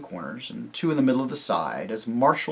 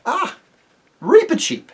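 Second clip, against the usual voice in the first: high-pitched exclamation of recognition; bright-pitched, surprised hello; pleased greeting; 8 dB louder than usual. A narrator's reading. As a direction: greet a small visitor, lifting the voice high. Peter greets the mouse.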